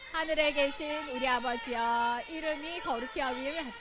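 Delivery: even, deliberate recitation; group prayer recitation. Real, not acted. Leads children reciting the Lord's Prayer.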